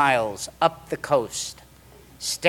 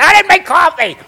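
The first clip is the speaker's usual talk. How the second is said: high, loud confession yell; hammed-up guilty shriek; guilty outburst; 13 dB louder than usual. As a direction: blurt it out at full volume. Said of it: "I'd be so guilty".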